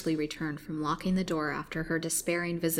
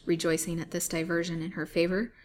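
ordinary delivery, nothing marked

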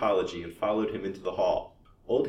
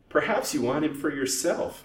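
higher-pitched sarcastic jab; 8 semitones higher, 4 dB louder than usual. A narrator's reading. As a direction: insinuate it with sarcasm. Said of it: "beginning to say sarcastically".

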